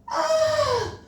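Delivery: very high, sustained "ah"; climactic cry. A narrator's reading. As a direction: sigh out a long, very high cry. Climax cry as rocket bursts.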